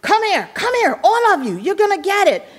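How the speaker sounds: high mock-scolding mother; angry mom. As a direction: imitate mom calling the kids in for punishment. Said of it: "my mother's like".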